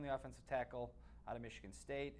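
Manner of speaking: ordinary talk, nothing marked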